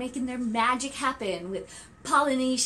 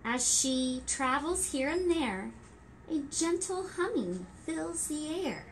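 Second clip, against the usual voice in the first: gentle rhyming storybook read; reading to children; 4 dB quieter than usual. Acted. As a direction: read it like story time for toddlers. Reading rhyming picture book.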